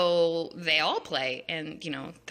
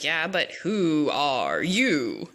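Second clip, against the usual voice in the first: very slow, lazy drawl; stoned caterpillar; 2 semitones lower, much slower than usual. Retold or imitated. Caterpillar questioning Alice, slow stoned drawl. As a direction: drag it out like he's baked. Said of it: "he's like".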